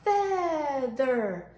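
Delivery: FEATHER lengthened over both syllables, falling; drawn-out Feather for repeating; word modelled. Shown as a demonstration. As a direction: lead the class in a slow Feather. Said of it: "Let's say that together".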